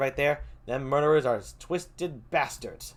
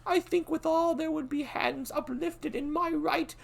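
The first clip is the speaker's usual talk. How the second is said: put-on much higher, cautious young prince; young Malcolm; 7 dB louder than usual. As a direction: weigh each word warily, pitched high.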